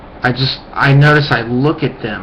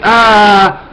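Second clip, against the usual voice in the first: sudden high loud "Ah!" cutting in; mock yell; 9 semitones higher, 9 dB louder than usual. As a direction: blurt a loud high yelp to talk over someone. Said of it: "they try to just, like, yell over you".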